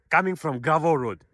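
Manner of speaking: normal talking voice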